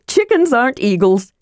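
very high, triumphant kender chirp; joyous. A narrator's reading. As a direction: crow with delight at being right. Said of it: "said Tasselhoff joyously".

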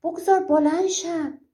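high-pitched narrated demand; mild storyteller's yell, pitched well up; hag's cry. A narrator's reading. Pinned hag demanding the hunter let her up, high mild shout. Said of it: "عجوزه فریاد زد".

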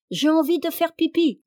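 put-on high-pitched child's whine to go out; crying-out children. A narrator's reading. Child interrupting Janvier's supper, high clamouring voice. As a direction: pipe up like an insistent child. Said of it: "les enfants se mirent encore à crier".

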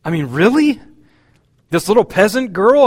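high, loud incredulous disbelief; can't believe the choice, rising; astonished question. Acted. Gabriel reacting to God's choice of Mary, astonished. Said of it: "And he says".

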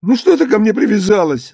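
high, whining exasperation; tearful cry. A narrator's reading. Husband snapping at his questioning wife, tearful cry. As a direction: wail it as if pestered to tears. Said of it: "закричал он плачущим голосом".

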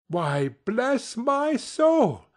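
high, slow astonished exclaim; Fred jumps at the sight of his uncle; startled cry. Acted. Fred startled by his uncle at the door, high surprised cry. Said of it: "cried Fred".

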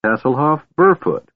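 high, bright, polite kender. A narrator's reading. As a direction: introduce yourself with a bow. Tass introducing himself.

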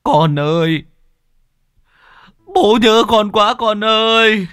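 loud, high, drawn-out sobbing wail; anguished father howling in tears; wailing cry. Acted. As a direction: cry out slowly for a missing child. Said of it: "Tiếng gào khóc của ông Lãm".

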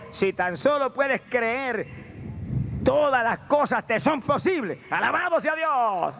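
high, slow, drawn-out preaching; full-force preaching. Acted. Preacher quoting the Lord to the congregation, full-force preaching. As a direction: stretch the words out high like a preacher.

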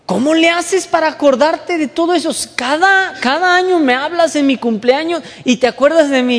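a friend marvelling that you remember; amazed friend. Retold or imitated. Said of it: "una persona un día le hace".

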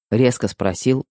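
plain reading voice

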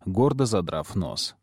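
ordinary delivery, nothing marked

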